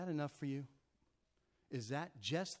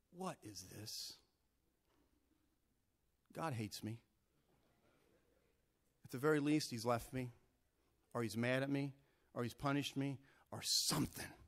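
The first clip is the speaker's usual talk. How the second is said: dejected complaint; 5 semitones lower than usual. Acted. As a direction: sound let down by God. Believer complaining God left him.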